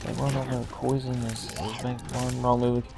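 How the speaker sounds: very soft, under the breath; quiet half-remembered muttering; muttering to recall. Real, not acted. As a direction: mumble it to yourself, searching for the words. Mumbles while recalling rap lyrics.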